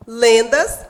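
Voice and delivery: "Lendas" with a loud first syllable; stress on LEN. Shown as a demonstration. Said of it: "é o lem". ("Lendas" com o len bem forte)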